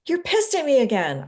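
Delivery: blunt accusation; accusing tone. Shown as a demonstration. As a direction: say it as a flat charge. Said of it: "instead of just saying".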